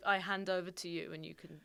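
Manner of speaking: ordinary talk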